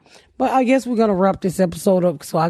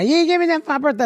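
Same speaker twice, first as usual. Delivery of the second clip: whiny mock complaint; 8 semitones higher, faster than usual. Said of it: "she didn't think".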